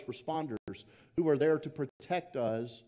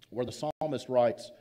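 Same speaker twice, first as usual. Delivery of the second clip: ordinary delivery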